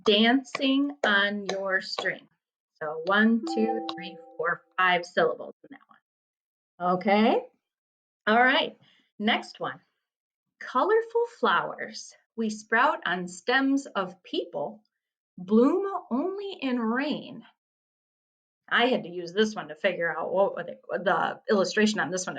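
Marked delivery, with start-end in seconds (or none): 0.0-2.3 "dancing on your string" slowly, each syllable separate. Shown as a demonstration. Teacher shows five syllables in "dancing on your string", syllable by syllable. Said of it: "has to have five syllables". 10.6-17.5 slow, higher-pitched haiku reading; poem reading. Acted. Teacher reads the next riddle to students, poem reading.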